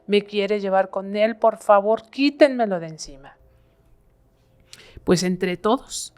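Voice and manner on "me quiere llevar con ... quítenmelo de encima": urgent plea, raised in pitch and volume; retold desperate cry. Possessed woman begs the family for help, desperate cry. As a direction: imitate a woman crying out for rescue. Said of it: "la voz de ella gritaba".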